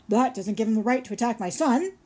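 loud, indignant yell; hollering. A narrator's reading. Father beaver yelling at robot about the pike, loud indignant holler. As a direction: shout in defense of his son. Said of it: "hollered Mister Beaver".

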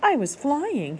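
high gosling squeak; proud little squeak. Acted. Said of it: "he squeaked".

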